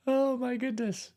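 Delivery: high, laughing oh my goodness; amused disbelief. Real, not acted. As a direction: marvel at the absurd story. Reacting to the story.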